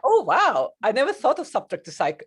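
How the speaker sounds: a sudden wow of discovery; real surprise. Real, not acted. Therapist hearing her co-host's new phrase, delighted surprise.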